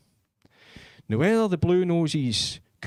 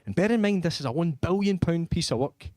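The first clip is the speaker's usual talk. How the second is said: usual speaking voice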